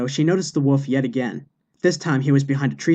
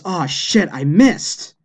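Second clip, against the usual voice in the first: higher, exasperated mild scream; mock scream; 3 semitones higher, 3 dB louder, much slower than usual. A narrator's reading. Priest misses the final hole, mock scream. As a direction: cry out in frustration at the miss. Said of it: "He screams".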